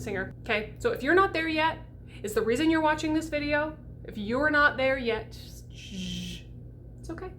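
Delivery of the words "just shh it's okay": soothing hush. Acted. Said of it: "soothing the unready student".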